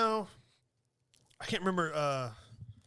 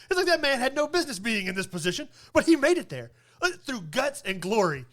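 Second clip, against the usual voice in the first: mock coach rant; 3 semitones higher, 6 dB louder than usual. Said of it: "this guy was portraying".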